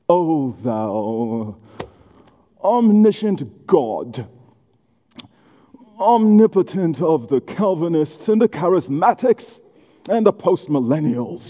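slow, drawn-out pompous praying; hammed-up solemn prayer with long pauses; mock prayer voice. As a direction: parody a lofty pray-er, stretching each grand word. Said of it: "like, a prayer voice".

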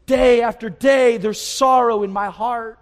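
slow, loud, drawn-out lament; crying out to God. Acted. Psalmist to God about daily sorrow, crying out. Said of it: "that's what David's crying out".

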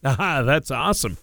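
ordinary talk, nothing marked

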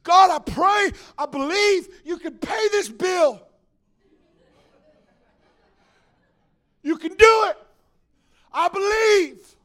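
high, loud, hammed-up prayer; mock desperate prayer of belief; fervent believer praying. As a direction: shout your faith up to God. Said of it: "How many of us have done this?".

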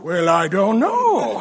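slow, low, drawn-out pretend doubt; coy mock reluctance. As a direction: stretch it out low, as if tempted. Imagined coy reply to grand offer.

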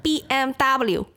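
mainland Chinese accent. Shown as a demonstration. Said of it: "my mainland Chinese, like".

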